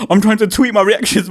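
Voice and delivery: ordinary talk, nothing marked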